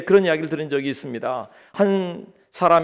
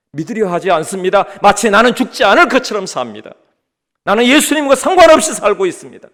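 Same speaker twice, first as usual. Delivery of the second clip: pitched-up sermon voice, building louder; forceful preaching; 7 semitones higher, 9 dB louder than usual. Acted. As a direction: build to an emphatic cry from the pulpit. (점점 힘주어 외치는 설교)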